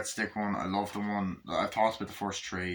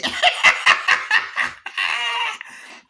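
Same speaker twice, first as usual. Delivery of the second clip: very loud, high maniacal cackle; Chucky; 9 dB louder than usual. Retold or imitated. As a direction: burst into long, gloating villain laughter. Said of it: "he starts laughing".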